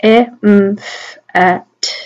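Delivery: phonemes of emphatic, each isolated; "E, M, F, A, T" as speech sounds; sounding out. Shown as a demonstration. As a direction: separate sounds with small gaps. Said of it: "sound them out".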